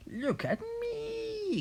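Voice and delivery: very high, stretched granny screech; rooftop shout. A narrator's reading. Grandma demanding attention from the rooftop, high drawn-out screech. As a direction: screech it, holding the last word long. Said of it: "Grandma shouted from the rooftop".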